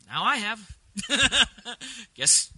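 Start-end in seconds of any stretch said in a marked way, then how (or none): 0.9-2.1 short chuckle at own joke; amused burst of laughter; real laugh. Real, not acted. Laughs at own joke about crying.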